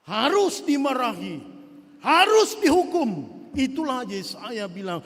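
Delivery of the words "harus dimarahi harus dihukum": stern loud verdict. Acted. Preacher pronounces punishment.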